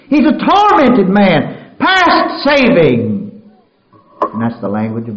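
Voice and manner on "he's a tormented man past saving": "tormented" cried out high, loud and slow; full-force preaching. Acted. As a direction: stretch "He's a tormented man" into a loud, slow cry. Repeated line preached loud.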